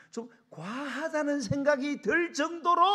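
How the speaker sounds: loud, high and slow, stretching 과하다; full-force emphasis. Real, not acted. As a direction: build it up into a preacher's cry. Repeats advice at full force.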